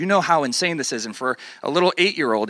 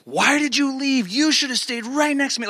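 high-pitched mock scolding; ticked parents; 12 semitones higher than usual. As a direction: imitate parents chewing out a kid. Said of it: "and be just ticked".

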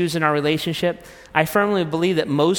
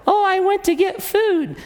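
wife's voice. Acted. Acted marital quarrel, wife.

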